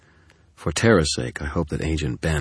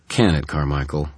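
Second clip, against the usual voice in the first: ordinary delivery, nothing marked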